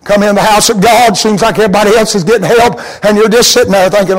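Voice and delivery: ordinary talk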